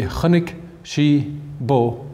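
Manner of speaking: very slow, spaced-out Irish sentence; word by word. Shown as a demonstration. Teacher shows Irish word order to listeners, word by word. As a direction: say each Irish word separately, slowly.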